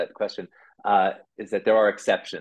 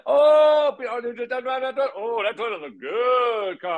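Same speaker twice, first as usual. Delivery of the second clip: much higher, slurred mechanic's mumble; mumbling mechanic. Retold or imitated. Mechanic Sonny explaining the car repair, high slurred mumble. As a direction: slur it all together like the old mechanic. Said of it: "my favorite person who mumbled".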